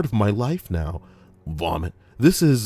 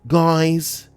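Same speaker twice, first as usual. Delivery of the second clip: high, earnest fake-caring YouTuber voice fading to a whisper; mock a preachy tuber, dragging out the last words; douchey YouTuber; 8 semitones higher, slower than usual. Vlogger parodying a charity streamer, high preachy voice. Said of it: "a douche tuber that is like".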